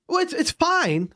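high, slow, exasperated echo; mock incredulous echo. Customer throwing the cafe worker's excuse back, incredulous.

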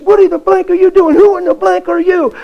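high, loud, indignant shout; angry yell. Retold or imitated. Girl shouting while pulling away from the pastor. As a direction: shout to get free of his grip. Girl struggling to break free.

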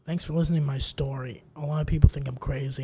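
hushed thanks from an oddball; quiet confiding. Retold or imitated. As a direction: confide it softly, imitating the stranger. Armed stranger confides in him.

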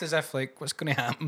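ordinary delivery

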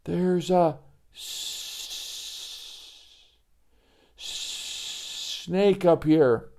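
breathless stuttering whisper; too scared to say snake; stammering fright. Acted. Joey stammers in fear.

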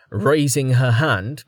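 ordinary delivery, nothing marked

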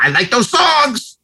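high, slow simpleton's voice; mock naive fan. Host mocking Oscar voters who confuse score and song, naive voice. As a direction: imitate a clueless moviegoer, drawn out and high. Mimics what people say.

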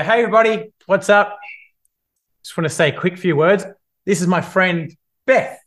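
tram speech opener. Shown as a demonstration. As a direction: introduce your friend to the carriage cheerfully. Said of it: "And I'm gonna go".

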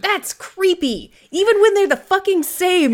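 high, loud exclamation; astonished outburst. Real, not acted. Host reacting to co-host's creepy accuracy, shouted. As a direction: start high and drawn-out, build to a shout.

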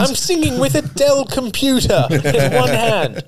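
high excited chat-show host; gushing talk-show host, pitched high; James Corden impression. Second comic mimicking Corden, high gushing host. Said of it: "There's two Cordons".

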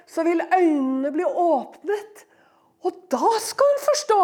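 ordinary delivery

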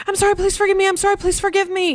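high, loud imitation of a begging kid; frantic mock pleading. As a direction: plead insincerely to escape trouble. Mimics kid's panicked, insincere apology.

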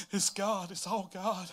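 hushed mock-crying confession; mock tearful. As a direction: play at being choked up. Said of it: "I start crying".

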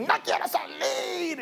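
high, slow, drawn-out demon protest; mock demon cry. Preacher imitating a demon during an exorcism, hammed-up high cry. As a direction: ham up a stubborn demon refusing to leave. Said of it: "A veces los demonios gritan".

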